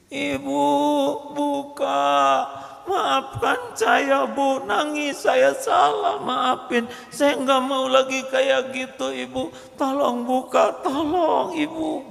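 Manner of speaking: put-on high, sobbing little child pleading through the door; crying child begging. Child locked out begs mother to open, weeping. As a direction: beg like a small child locked outside.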